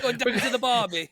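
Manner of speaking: high, loud mock Aussie line; hammed-up Australian accent. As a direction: ham up an Aussie going to the barbie. Australian impressions round.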